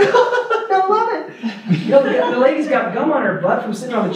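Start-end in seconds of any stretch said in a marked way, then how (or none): 0.0-1.8 high, slow, laughing delight; laughing mock delight. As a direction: stretch it out giggling, much higher. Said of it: "He's like".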